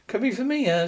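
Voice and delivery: plain reading voice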